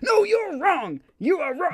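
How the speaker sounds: high, loud mock outrage in a put-on accent; aggressive guest. Host imagining an aggressive podcast guest, high loud mock fury. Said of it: "someone who's really aggressive".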